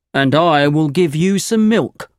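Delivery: lightly voiced demanding farmer. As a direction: set terms as a hard-bargaining farmer.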